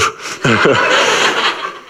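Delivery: breathy real laugh. Real, not acted. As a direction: let a real laugh slip out. Laughing at his own story.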